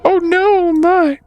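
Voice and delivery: very high falsetto cry of fright; terrified woman. Acted. Woman inside hears the trouble, terrified falsetto. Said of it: "Very terrified".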